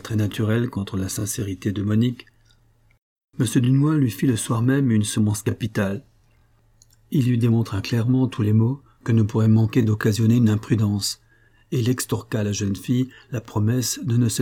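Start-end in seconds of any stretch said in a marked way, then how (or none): none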